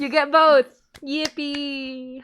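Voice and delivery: high, loud gleeful cheer; excited. Real, not acted. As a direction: squeal it with glee. Gleeful cheer about episode.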